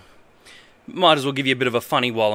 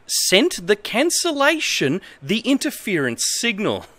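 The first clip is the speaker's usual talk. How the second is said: reads broken manual text in a lightly formal voice; straight-faced reading of nonsense instructions; mock-earnest manual reading; 6 semitones higher than usual. Reads bad manual translation aloud.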